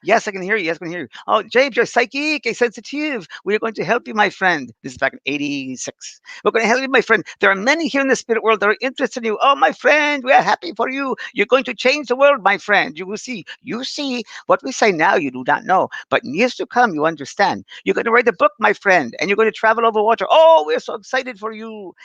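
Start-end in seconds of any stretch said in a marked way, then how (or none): 0.0-4.7 put-on French-accented spirit voice, high; hammed-up Frenchman, raised pitch; big Frenchman. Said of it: "and this voice". 6.4-21.9 put-on high, effusive French-accented spirit; hammed-up Frenchman, raised pitch; big Frenchman. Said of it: "and this voice".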